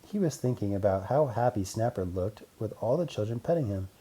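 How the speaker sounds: ordinary delivery, nothing marked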